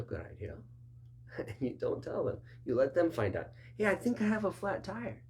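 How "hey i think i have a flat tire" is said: high, bright-eyed realization; surprised discovery. Retold or imitated. Client finally noticing the problem, surprised. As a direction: sound like you just discovered it.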